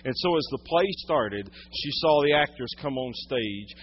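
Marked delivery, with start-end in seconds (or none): none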